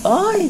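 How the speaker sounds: loud high mock scream; retold possessed woman's scream; ugly scream. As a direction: cry out harshly. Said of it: "así muy feo".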